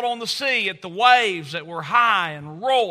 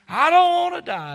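high, loud mimicked wail; imitated blood-curdling cry; 10 semitones higher, 7 dB louder than usual. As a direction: cry out like a frightened little girl. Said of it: "in the tone that she used".

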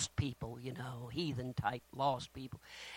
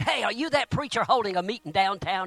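loud, high hail from a big fella; heavy-set fella; 7 semitones higher, 9 dB louder than usual. Retold or imitated. A big man hails the preacher on the golf course, calling out loudly. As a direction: call out like a brash big man. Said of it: "a big, heavy-set fella came to him".

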